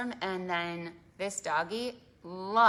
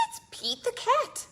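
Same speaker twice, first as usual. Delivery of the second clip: excited; 5 dB louder than usual. Acted. Excited reveal to kids.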